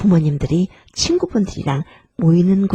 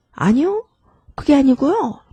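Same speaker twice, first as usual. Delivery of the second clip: higher-pitched objecting reply; mock contradiction; 7 semitones higher than usual. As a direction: cut in to correct, pitched up. Example of abrupt negative replies. (반박하듯 높은 톤으로)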